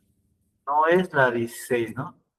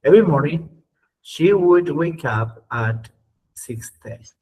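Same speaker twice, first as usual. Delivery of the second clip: slow model reading; 3 semitones higher, 4 dB louder, slower than usual. Shown as a demonstration. Teacher reads the English example slowly to the class. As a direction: read slowly, word by word.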